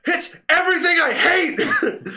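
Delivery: high, loud hammed-up crying wail; mock wail. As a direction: wail it like a hammed-up sob, slow. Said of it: "he's in the background going".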